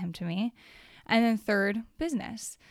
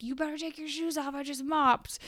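mock yell; 6 semitones higher than usual. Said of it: "I yelled at my husband".

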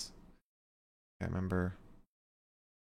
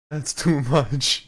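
laughing; 4 semitones higher, 5 dB louder, much slower than usual. Real, not acted.

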